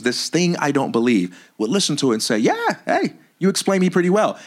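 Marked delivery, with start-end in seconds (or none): 2.4-4.4 pleased believer voice. Retold or imitated.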